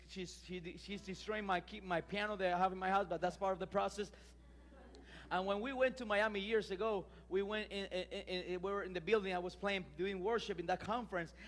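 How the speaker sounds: usual speaking voice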